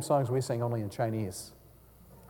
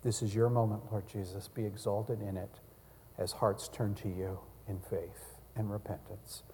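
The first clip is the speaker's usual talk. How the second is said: prayer; 5 semitones lower, 11 dB quieter than usual. Real, not acted. Closing prayer.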